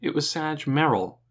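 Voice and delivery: usual speaking voice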